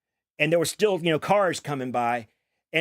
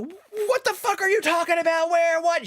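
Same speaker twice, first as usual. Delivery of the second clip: mom freaking out; 14 semitones higher than usual. Retold or imitated. Mom hearing her son was hit by a truck, shrieking in disbelief. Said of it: "She just blew a gasket".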